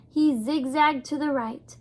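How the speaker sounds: ordinary delivery, nothing marked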